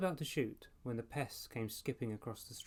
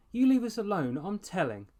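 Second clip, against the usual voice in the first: much higher, much louder whining threat; whiny shriek; 9 semitones higher, 10 dB louder than usual. A narrator's reading. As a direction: wail it like a kid running to tell. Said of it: "Ashley whined".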